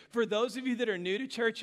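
ordinary delivery

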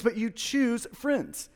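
usual speaking voice

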